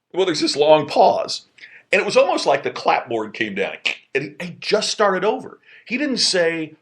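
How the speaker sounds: ordinary delivery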